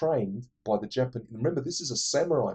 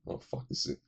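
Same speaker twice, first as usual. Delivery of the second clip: whispered aside; 11 dB quieter than usual. Retold or imitated. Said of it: "Van Damme looks at him".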